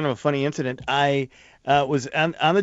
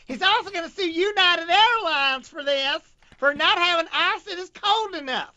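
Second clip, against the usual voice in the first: high, slow, shrill TV host voice, laughing; mock Nancy Grace. Joking about a lawsuit, shrill slow TV host mockery. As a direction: drag it out high like a scandal host.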